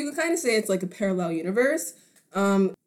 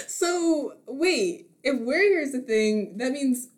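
high, laughing mock astonishment; 6 semitones higher, 3 dB louder than usual. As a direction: giggle through the fake realization. Mock discovery in podcast skit.

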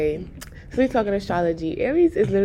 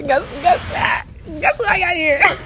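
higher, playful build-up to the reveal; excited teasing; 8 semitones higher, slower than usual. Real, not acted. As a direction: tease the audience before the surprise.

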